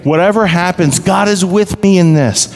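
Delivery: high, ringing sermon delivery; impassioned preaching. Real, not acted. Pastor declaring God's promise to his congregation, impassioned preaching. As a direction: preach it with rising conviction, pitched well up.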